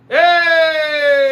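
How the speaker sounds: long, loud, high "hey"; excited cheer. Real, not acted. Cheering at the show.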